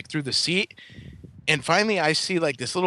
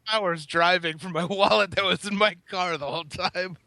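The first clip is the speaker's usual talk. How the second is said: laughing; 4 semitones higher than usual. Real, not acted. Laughing at own wasted drive.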